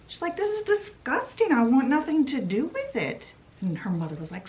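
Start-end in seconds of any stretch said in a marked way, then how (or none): 0.4-3.3 high-pitched, revolted refusal; acted disgust. Daughter wants nothing to do with it, high disgusted protest. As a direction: refuse indignantly, pitch jumping up.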